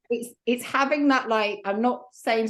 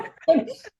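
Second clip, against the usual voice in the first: a squealing laugh at herself; high burst of laughter; 9 semitones higher, 5 dB louder than usual. Real, not acted. Laughing at the honey mishap with friends.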